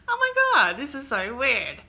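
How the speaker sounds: high-pitched, loud, squealed freak-out; high silly voice. Retold or imitated. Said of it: "What's with that voice?".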